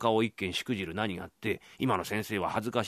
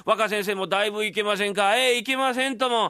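speaking up; 9 semitones higher, 5 dB louder than usual. Acted. Loud talk with deaf lady.

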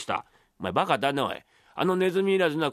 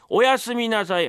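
high, put-on son's goodnight; giggly lead-in, higher dutiful call; imitating the young master; 4 semitones higher than usual. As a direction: cover up with a mimicked polite voice. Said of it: "俺の声色がうまい".

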